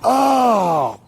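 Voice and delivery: high drawn-out oh of understanding; sudden realization. Retold or imitated. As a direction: it all clicks now. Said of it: "I'm like".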